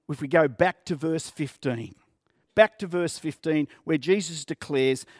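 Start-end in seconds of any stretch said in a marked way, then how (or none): none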